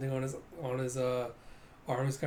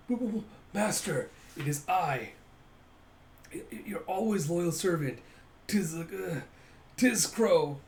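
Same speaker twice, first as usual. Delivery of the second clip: higher-pitched, stammering loyal servant; raised, halting henchman voice; servile minion; 4 semitones higher than usual. Acted. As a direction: stammer like a fawning underling. Servant announces himself to master.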